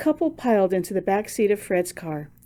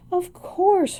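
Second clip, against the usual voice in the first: laughing; 11 semitones higher than usual. A narrator's reading. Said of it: "her mother laughed".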